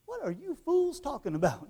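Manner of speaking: high-pitched mock exasperation; imagine Jesus breaking into a silly argument; imagined exasperated Jesus. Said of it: "hear the tone of voice Jesus says".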